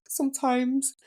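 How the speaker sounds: high-pitched, hammed-up tearful reply; mock sobbing. Crying patient shrugging at the nurse's question, mock tearful. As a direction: imitate answering between sobs. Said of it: "I shrugged in between sobs".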